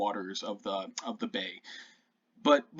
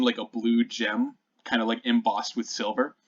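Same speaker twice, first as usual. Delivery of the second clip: ordinary talk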